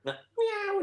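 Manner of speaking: high mock cat meow; silly meow. Recalling pool pranks, a high silly meow. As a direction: squeak out a daft meow. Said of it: "those silly noises".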